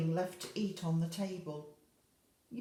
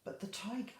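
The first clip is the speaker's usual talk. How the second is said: usual speaking voice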